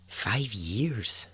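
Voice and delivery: hushed, questioning echo of the answer; stunned question. Retold or imitated. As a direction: repeat it softly as a question, in quiet disbelief. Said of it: "they ask him".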